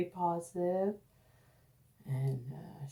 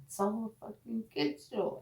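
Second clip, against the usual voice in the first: usual speaking voice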